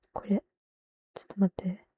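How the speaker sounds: a soft whisper of wait a moment. Real, not acted. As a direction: whisper to yourself while checking. Pausing to look something up.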